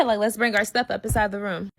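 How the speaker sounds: usual speaking voice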